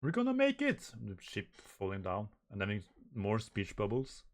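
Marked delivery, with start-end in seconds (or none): 0.0-0.9 high-pitched cry of hope; excited cry. Acted. Crew cries out as ship falls, excited.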